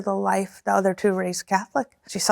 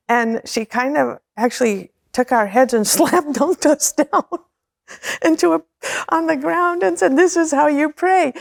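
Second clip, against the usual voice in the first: laughing while telling; 5 semitones higher than usual. Real, not acted. Laughing through the prayer story.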